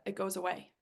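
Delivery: normal talking voice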